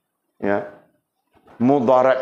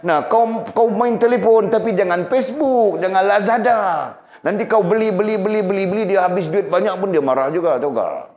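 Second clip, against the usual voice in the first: much higher and louder, pressing the warning; a raised, urgent scold about spending; heated warning; 9 semitones higher, 3 dB louder than usual. Acted. Preacher warning wives off shopping apps, high urgent scold.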